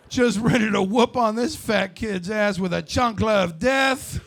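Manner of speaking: ordinary delivery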